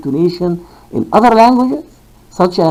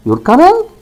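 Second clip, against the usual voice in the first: "You're coming" high, climbing steeply on "coming"; question intonation; 13 semitones higher, 4 dB louder than usual. Shown as a demonstration. Teacher showing learners the second style, high rising question intonation. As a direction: send "coming" sharply upward as a question. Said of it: "This is question".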